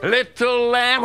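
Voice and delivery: usual speaking voice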